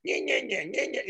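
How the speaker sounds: high, whiny nonsense squabbling; mock bickering. Imitating the fight before the envelope, high mock bickering. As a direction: mimic petty back-and-forth nagging in a high voice.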